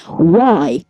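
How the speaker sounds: a loud, exasperated single "Why?"; sudden shout. Real, not acted. Vlogger yelling at his own race mid-commentary, loud exasperated shout. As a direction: blurt it out at full volume.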